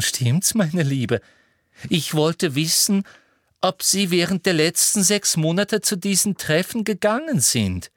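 coaxing that climbs into high insistence; Umbridge, pressing. Acted. Umbridge repeats her question to Marietta, increasingly insistent.

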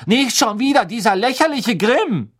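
mock the prophecy loudly; loud scornful outburst. A narrator's reading. Hermine scoffing at the Grim prophecy, loud and scornful. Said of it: "sagte Hermine laut".

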